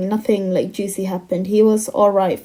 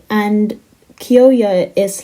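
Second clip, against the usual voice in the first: ordinary talk, nothing marked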